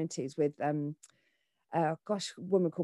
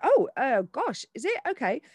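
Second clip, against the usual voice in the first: mock surprise; 8 semitones higher, 4 dB louder than usual. Mimicking surprised fans.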